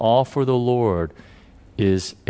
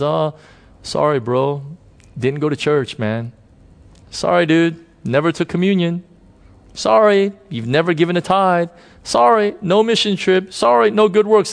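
casual brush-off, climbing higher with each excuse; mock apology; 6 semitones higher than usual. Preacher imagines Jesus turning away the thief, mock apology. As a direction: pretend to turn someone away, rising in pitch as the list builds.

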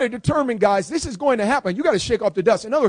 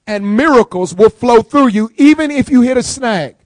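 louder, pressing on "even"; louder emphatic repeat; 3 semitones higher, 7 dB louder than usual. Shown as a demonstration. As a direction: preach it out loud again. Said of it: "Let me say that again".

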